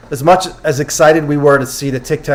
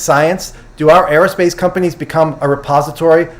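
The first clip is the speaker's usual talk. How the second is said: normal talking voice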